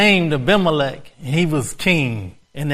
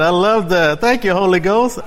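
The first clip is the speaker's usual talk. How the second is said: ordinary talk, nothing marked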